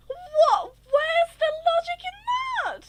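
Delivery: loud, high outraged what; high indignant rant; exasperated shout. Real, not acted. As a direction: yell it in disbelief at the logic. Ranting about no walls.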